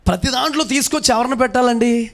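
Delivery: high, loud preacher's question; rousing question. Real, not acted. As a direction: prompt the crowd to answer. Quizzing congregation loudly. (గొంతు పెంచి గట్టిగా ప్రశ్న)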